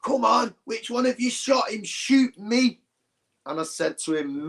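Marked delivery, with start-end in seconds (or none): 0.0-2.8 high-pitched mock challenge to armed lads; mate squaring up to gunmen, a retold high yell; retold shouting. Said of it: "and he's shouting".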